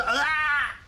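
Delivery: high, loud "ah" yell; sudden yell. Real, not acted. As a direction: burst out with a high, strained shout. Mid-story yell of pain or strain.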